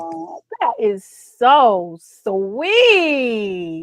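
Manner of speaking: touched. Real, not acted. Son's birthday card read aloud.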